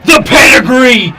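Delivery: high, loud, shouting the near-fall; excited wrestling commentator. Acted. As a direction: yell the big move live. Live match play-by-play.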